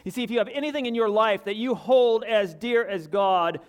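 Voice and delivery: high and loud, pressing each word home; impassioned preaching. Real, not acted. Preacher testifying to congregation, impassioned preaching. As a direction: preach it with fire.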